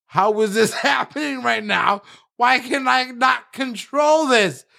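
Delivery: panicked shout. Retold or imitated. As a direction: yell out helpless disbelief. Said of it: "he's like".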